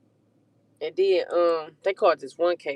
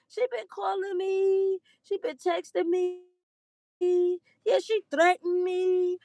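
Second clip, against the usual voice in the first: mock girlish complaint; 11 semitones higher, slower than usual. High slow voice quoting girl's texts.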